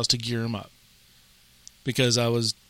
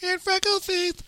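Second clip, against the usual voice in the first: put-on much higher, soft little-girl voice; little niece voice; 5 dB quieter than usual. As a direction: play the kid cheerfully confessing. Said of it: "I can see her".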